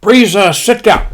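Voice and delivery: high, loud mock-Japanese admiral. Admiral greeting his assembled pilots, mock-Japanese bark. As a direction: bark it in a put-on Japanese accent. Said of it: "he says".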